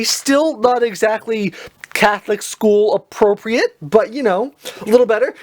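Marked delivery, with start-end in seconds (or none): none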